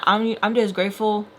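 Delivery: normal talking voice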